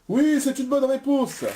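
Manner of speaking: high-pitched game-show approval; delighted confirmation. Real, not acted. Quiz host approving a contestant's answer, delighted.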